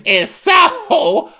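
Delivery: very high, slow, laughing gush; fake delight. Imitating Shannon's fake amusement at the healer. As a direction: stretch it out with a giddy laugh.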